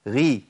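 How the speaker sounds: "غي" with kasra, the ghayn a little lighter; slightly thinned ghayn. Shown as a demonstration. Teacher demonstrating kasra's effect, slightly thinned ghayn. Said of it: "تميل للرقة شوية".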